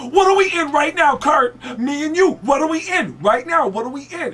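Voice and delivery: mock-angry high, loud interrogation; Tracy Morgan. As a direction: demand it in a hammed-up impersonation. Tracy's roadside grilling of Kurt.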